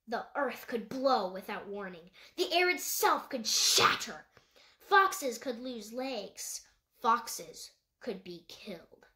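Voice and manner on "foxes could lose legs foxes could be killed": usual speaking voice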